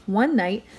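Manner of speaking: ordinary delivery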